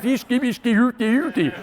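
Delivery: lightly voiced Muppet Swedish chef gibberish; playful mock chef gibberish. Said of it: "they're talking like Muppets".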